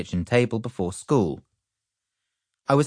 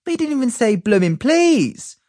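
indignant protest; 5 dB louder than usual. A narrator's reading. Indignant child.

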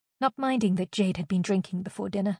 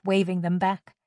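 plain reading voice

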